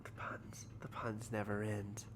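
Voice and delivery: unvoiced whisper, slow and weary; whispered aside. Real, not acted. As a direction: breathe it out like a tired groan at the puns. Groaning aside about puns.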